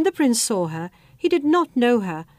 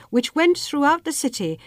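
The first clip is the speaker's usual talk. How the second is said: ordinary delivery, nothing marked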